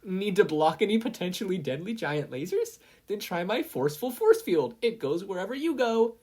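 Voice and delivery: high, loud infomercial voice; defiant ad pitch. Acted. Mina advertising her force field against Mei, defiantly. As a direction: counter with a bright sales line.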